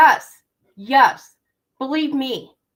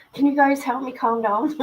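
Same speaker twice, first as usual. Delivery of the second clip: ordinary talk, nothing marked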